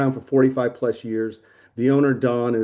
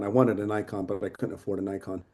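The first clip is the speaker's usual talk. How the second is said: quiet; 5 semitones lower, 7 dB quieter than usual. Real, not acted. Repeats complaint, second time dropped.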